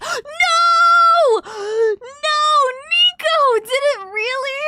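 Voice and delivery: high, loud tearful cry of dismay; dismayed wail. Real, not acted. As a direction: wail out in horror at your own mistake. Dismayed that block removed Nico's follow.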